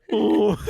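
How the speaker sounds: high, loud-ish whale "ooh"; drawn-out high whale hoot; whale sound. Shown as a demonstration. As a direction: call out like a whale. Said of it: "I like a whale sound".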